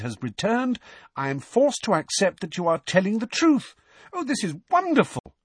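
excited, high-pitched reading aloud; Hermione. A narrator's reading. Girl reading a reader's letter to her friend, excited. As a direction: read it out high and thrilled, peaking at the end. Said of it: "said Hermione excitedly".

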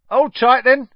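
high, loud chirpy reply; cheery conductor. Acted. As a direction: snap it back bright and loud like Stan. Stan warns before the bus lurches.

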